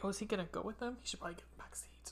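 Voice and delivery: hushed aside to oneself while watching; very soft, secretive wondering; whispered thought. Retold or imitated. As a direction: drop into a barely voiced whisper. Voices own wary thought while watching.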